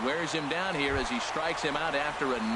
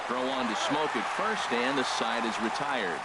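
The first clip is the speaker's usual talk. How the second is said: radio baseball announcer. Acted. Play-by-play of the inning.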